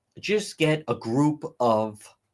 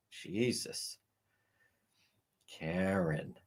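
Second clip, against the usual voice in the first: ordinary delivery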